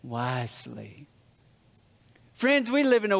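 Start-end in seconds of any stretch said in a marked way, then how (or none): 0.0-1.1 low, softer grave knight's verdict; a hint of the old knight, quiet and grave; solemn knight. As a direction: intone it like an ancient guardian. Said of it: "the knight looks at him and goes".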